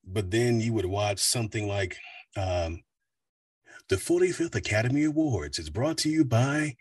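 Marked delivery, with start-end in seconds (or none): 3.9-6.8 smooth announcer. Shown as a demonstration. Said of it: "just very smooth".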